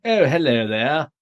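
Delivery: mock over-the-top British accent, slow; fake British accent. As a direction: imitate an American faking a British accent. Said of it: "the way that Americans think we talk".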